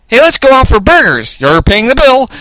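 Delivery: high-pitched sidekick voice; perky Maya. A narrator's reading. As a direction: suggest it up high and cheekily. Maya proposing burgers.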